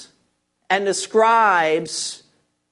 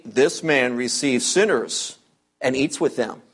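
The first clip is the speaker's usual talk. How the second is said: murmuring Pharisees; 10 semitones lower, 4 dB quieter than usual. Retold or imitated. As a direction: grumble it low like a disapproving religious man. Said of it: "the scribes murmured, saying".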